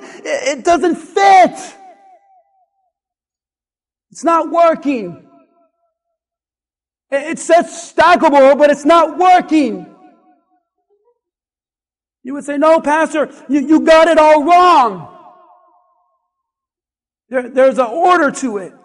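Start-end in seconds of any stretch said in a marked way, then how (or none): 0.0-9.9 loud, exasperated complaint, slowing on it doesn't fit; the bowls won't stack and he's fed up; frustrated outburst. Retold or imitated. Pastor pretending he can't fit the bowls, exasperated loud complaint. 12.6-18.7 loud, know-it-all correction; mock correction. A listener correcting the pastor's stacking, loud scolding.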